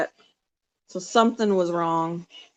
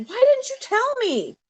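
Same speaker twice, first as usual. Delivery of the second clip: high, urgent retelling of her question to her son; mother's startled question, retold; retold alarmed mom. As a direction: replay the worried question up high. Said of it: "I said".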